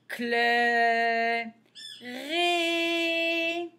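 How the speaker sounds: each syllable drawn out, with a pause after it; slow syllable reading. Shown as a demonstration. Teacher reading syllables for beginners, slowly sounded out. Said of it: "on va plus vite".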